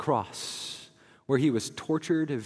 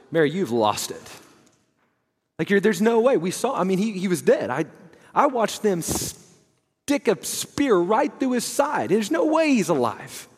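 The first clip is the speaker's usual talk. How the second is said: loud, higher, exasperated disbelief; doubting disciples; 5 semitones higher, 4 dB louder than usual. Retold or imitated. Disciples, louder, tell Mary there's no way. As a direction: scoff at her news, voice raised. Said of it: "The guys are going like".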